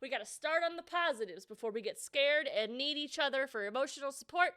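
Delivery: ordinary talk, nothing marked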